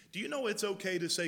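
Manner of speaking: ordinary talk, nothing marked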